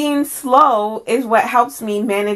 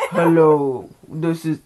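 mocking put-on voice; 5 semitones lower, slower than usual. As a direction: lower mock character voice. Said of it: "and then you're like".

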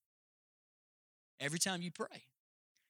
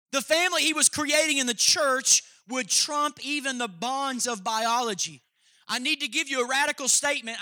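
preaching at full force; 4 semitones higher, 7 dB louder than usual. Acted. Pastor preaching to the church, loud and emphatic. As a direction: drive the point home from the pulpit.

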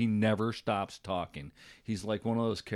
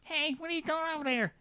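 much higher mocking voice; mocking impression. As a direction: mimic him high to needle him. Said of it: "some guy starts impersonating him".

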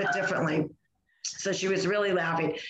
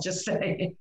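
whispered aside. Real, not acted. Cheeky aside after joke.

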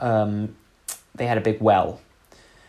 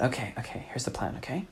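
hushed; 11 dB quieter, faster than usual. Retold or imitated. Hushed plan at the well.

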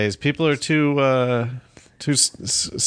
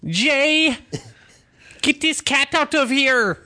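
Sofia Vergara; 13 semitones higher, much slower than usual. Retold or imitated. Comedian mimics sitcom wife calling Jay, high exaggerated accent. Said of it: "the Modern Family lady".

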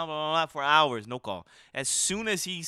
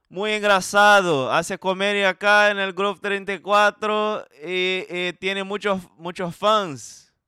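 hammed-up announcer to mother; 4 semitones higher, 8 dB louder than usual. To his friend's mom on FaceTime, a loud mock-formal tribute. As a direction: grandly hype up her son.

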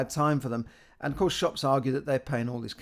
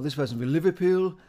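put-on quick Scouse accent, higher and louder; Liverpool accent; 4 semitones higher, 4 dB louder than usual. Imitating a Liverpool tourist overheard in central London, Scouse accent. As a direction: drop into a Liverpool accent for one line. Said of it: "you can hear an accent".

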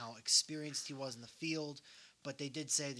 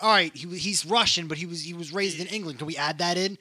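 loud mock director; 3 semitones higher, 12 dB louder than usual. Acting out the casting chat.

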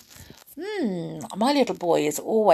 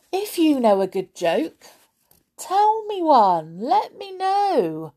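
ordinary delivery, nothing marked

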